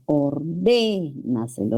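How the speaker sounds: Ordénaselo very slow, DÉ held long; stretched stressed syllable. Shown as a demonstration. Teacher showing a sobresdrújula word, Ordénaselo with DÉ held long. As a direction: hold the dé of Ordénaselo at half speed. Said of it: "sobre esdrújula y debe estar".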